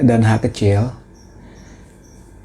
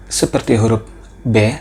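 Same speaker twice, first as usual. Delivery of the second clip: ordinary talk, nothing marked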